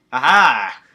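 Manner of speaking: loud gloating aha; loud victorious cackle of a shout; triumphant shout. Acted. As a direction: crow over the trap's success. Akarsha's trap succeeds.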